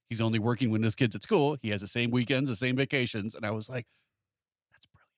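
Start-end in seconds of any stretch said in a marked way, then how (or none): 4.7-5.1 breathy awed whisper. Retold or imitated. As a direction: whisper it in quiet admiration. Awed aside to herself.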